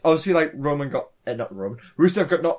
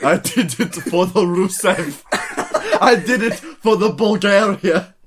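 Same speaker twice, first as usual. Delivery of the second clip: put-on slow, laughing Bulgarian accent; 2 semitones higher, slower than usual. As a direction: ham up a wrestler's Bulgarian accent. Mock Rusev catchphrase, laughing.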